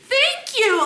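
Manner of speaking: very high, exclaimed vindication; emphatic agreement. Retold or imitated. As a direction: exclaim it, thrilled someone else noticed. Retold relieved agreement with mom.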